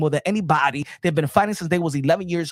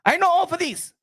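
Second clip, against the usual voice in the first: high, raised-pitch take of the line; Pacquiao impression; 13 semitones higher than usual. Boasting as Pacquiao, high take. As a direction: say I know all for this pitched high. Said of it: "I be Mario Barrios".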